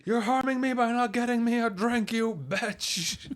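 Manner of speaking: high, mock furious drunk rant; barking tyrant boss. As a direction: yell at the robot like a bully. Owner berating robot.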